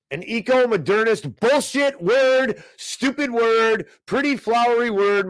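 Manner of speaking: high, drawn-out mockery of jargon; high-pitched sarcastic sing-out; mocking rant. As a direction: stretch the words out high with exasperated scorn. Mocking the article's fancy jargon.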